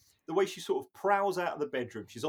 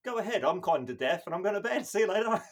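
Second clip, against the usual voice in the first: mock old dad; 6 semitones higher, 7 dB louder than usual. Mock Martin sending everyone off.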